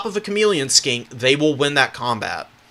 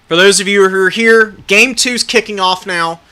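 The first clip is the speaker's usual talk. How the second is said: announcer; 5 semitones higher, 6 dB louder than usual. Acted. Caster announces game two.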